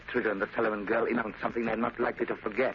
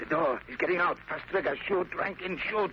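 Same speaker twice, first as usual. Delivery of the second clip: higher, urgent panicked orders; 6 semitones higher than usual. Acted. The boss ordering Rankin to shoot, panicked.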